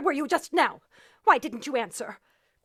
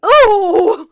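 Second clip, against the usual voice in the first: startled cry; 10 dB louder than usual. Acted. Startled by operator.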